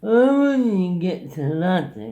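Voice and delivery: loud, drawn-out mimicked heckle; obnoxious yelling. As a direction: call it out slowly, pestering a stranger. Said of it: "this rather obnoxious Finn went".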